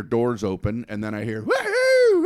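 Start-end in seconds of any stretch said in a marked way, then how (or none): 1.4-2.3 high, loud hunter whoops; whooping cheer. Retold or imitated. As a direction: whoop like a rowdy hunter celebrating.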